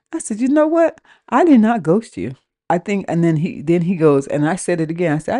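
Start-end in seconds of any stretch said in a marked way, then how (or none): 0.4-2.4 high, firm retort; acted-out protest on the phone; indignant denial. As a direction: push back on the accusation with raised pitch. Retold phone quarrel, denying the accusation.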